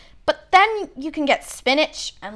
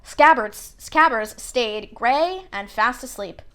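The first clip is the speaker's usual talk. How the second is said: ordinary delivery, nothing marked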